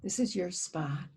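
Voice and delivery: guided meditation. Acted. Leading listeners in a guided earth meditation, calm and hushed. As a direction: ease listeners inward, letting phrases fade to near whisper.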